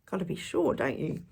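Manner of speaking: ordinary delivery, nothing marked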